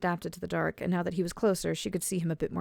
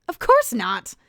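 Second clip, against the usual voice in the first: loud, sharp snap; snapped; 6 semitones higher, 12 dB louder, slower than usual. A narrator's reading. Alice snaps at Oswin over her totaled car, angry. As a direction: bite his head off. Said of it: "Alice snapped".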